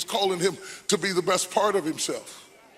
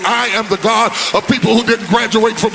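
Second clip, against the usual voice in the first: chanted, shouted litany, climbing higher and louder; full-force preaching; 6 semitones higher, 11 dB louder than usual. Acted. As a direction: preach it over the organ, building to a roar. Escalating preached litany over music.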